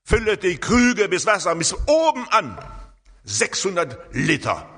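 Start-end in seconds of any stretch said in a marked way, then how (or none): 0.0-2.6 high, loud clear command; commanding order. Retold or imitated. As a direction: call it out as an order. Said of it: "Jesus sagt hier einen klaren Befehl". (klarer, lauter Befehl)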